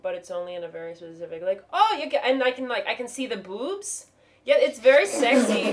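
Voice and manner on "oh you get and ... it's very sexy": high, loud Turkish-accented comic. Shown as a demonstration. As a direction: imitate a Turkish comic's cadence. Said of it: "I can sort of do a Turkish accent".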